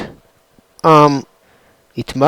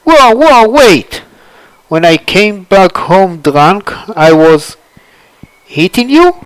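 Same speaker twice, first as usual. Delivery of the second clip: shocked outburst; 2 semitones higher, 5 dB louder than usual. Acted. Cloud hears he beat his wife, shocked loud outcry. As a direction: yelp in disbelief at the news.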